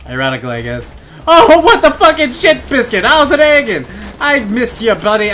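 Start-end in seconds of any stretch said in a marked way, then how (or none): 1.3-5.3 loud, high, hammed-up surprise; over-the-top greeting. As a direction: holler a mock hello to an old buddy. Mock greeting to a game character.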